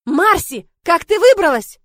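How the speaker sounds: high, loud startled yell; cry of alarm. A narrator's reading. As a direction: yell it out in alarm at the runaway pet. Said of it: "я услышала вопль". (встревоженный вопль)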